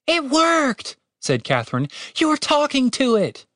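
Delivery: high-pitched, excited exclamation; amazed delight. A narrator's reading. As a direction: burst out in surprise. Catherine amazed the accent worked.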